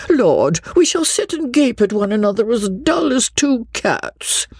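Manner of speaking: high-pitched, comically forlorn chatty lady; lamenting matron. A narrator's reading. Mrs Jennings tells the Colonel how forlorn they will be, high theatrical lament. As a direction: lament it with theatrical dismay, climbing high at the end.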